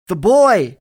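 very loud, high cry; urgent shout. A narrator's reading. Soldier answering his shouting comrade, yelled. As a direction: shout it out with force.

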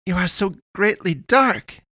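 high, loud, odd declaration; high and emphatic, as an otherworldly girl; declaring alien pastoress. A narrator's reading. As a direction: declare it in a high-pitched character voice. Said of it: "pointed at him with her free hand and declared".

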